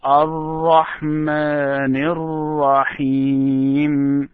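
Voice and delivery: Ar-Rahmanir Rahim joined in one slow breath; joined recitation. Shown as a demonstration. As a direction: link Ar-Rahmanir straight into Rahim, slowly. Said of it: "Try to catch this with me".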